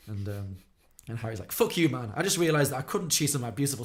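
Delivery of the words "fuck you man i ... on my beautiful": louder, higher acted outburst; mock indignant comeback; angry retort. Harry snapping back at Perry's taunt, angry.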